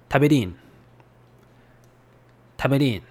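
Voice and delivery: hometown Mikawa "Taberin"; Mikawa dialect. Shown as a demonstration. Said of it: "in Mikawa dialect".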